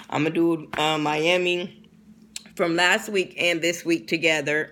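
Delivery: ordinary talk, nothing marked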